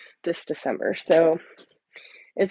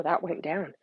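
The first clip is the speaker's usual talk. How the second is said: usual speaking voice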